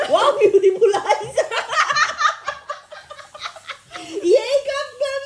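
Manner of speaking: high delighted laughing praise. Real, not acted. (masayang papuri habang tumatawa)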